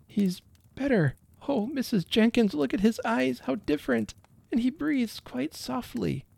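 high-pitched, tender relief; mother's hushed delight in a high voice; relieved wonder. A narrator's reading. Mother sees her baby recovering.

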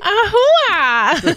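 high laughing festive yell; joyful cry. Real, not acted. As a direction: shout it out with a laugh. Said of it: "Mexican cry of joy".

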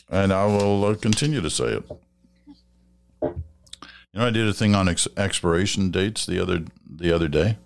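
ordinary talk, nothing marked

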